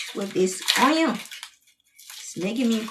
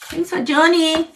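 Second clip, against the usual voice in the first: bright greeting; 7 semitones higher, 8 dB louder than usual. Real, not acted. Greeting livestream viewers.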